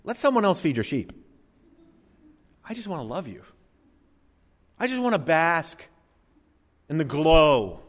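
a hint of a mushy believer who wants only feelings; sentimental Peter. Preacher voices Peter refusing work, in mock sentimental tones. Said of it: "if Peter is thinking".

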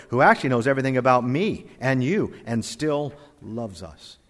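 ordinary talk, nothing marked

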